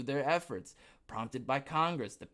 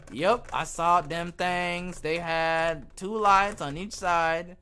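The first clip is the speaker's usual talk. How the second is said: put-on slow country accent, higher and louder; thick country accent; 4 semitones higher, 7 dB louder, slower than usual. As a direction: stretch every word in your thickest country accent. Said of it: "I'd say it with the thickest accent I got".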